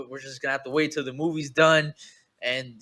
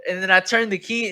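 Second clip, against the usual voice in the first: usual speaking voice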